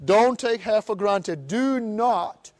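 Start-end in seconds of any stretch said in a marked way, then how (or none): none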